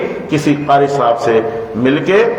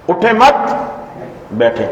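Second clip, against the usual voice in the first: اٹھیں مت raised together, break, then بیٹھیں drops; pause after مت; 3 semitones higher than usual. Shown as a demonstration. Teacher repeating the new pause to students, a break after مت. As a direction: stop after مت before بیٹھیں. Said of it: "اب میں نے وقف کیا اٹھیں مت".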